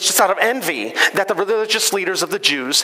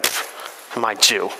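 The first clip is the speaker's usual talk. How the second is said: lower, dismissive scoff, mildly imitated; snotty Pilate; 8 semitones lower, 4 dB quieter than usual. Preacher imitating Pilate scoffing at Jesus, lower and snotty. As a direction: brush off Jesus with a sniff. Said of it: "Pilate's kind of snotty".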